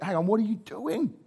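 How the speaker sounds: can't believe the rabbi is doing this; a disciple protesting, half-acted disbelief; incredulous disciple. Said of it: "They would have been looking around".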